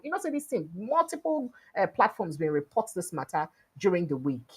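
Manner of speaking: ordinary talk, nothing marked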